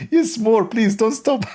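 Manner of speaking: very high, giddy god voice; mock delighted god. Speaker mocks Allah enjoying sneezes in squeaky delight. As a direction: squeal as an overjoyed deity. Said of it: "like Allah, like".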